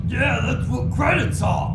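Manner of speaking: slow, loud, high dim-witted ogre; dumb ogre voice. Acted. Ogre answers the haggling party, slow and dim. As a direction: drag it out like a slow brute. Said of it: "ogre goes".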